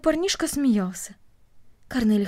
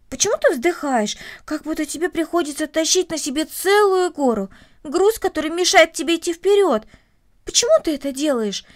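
higher, louder, insistent questioning; puzzled challenge; 6 semitones higher, 5 dB louder than usual. Acted. Boy asking the girl why she sighs, insistent. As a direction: press for the reason, baffled.

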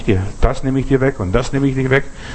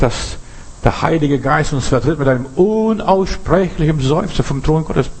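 usual speaking voice

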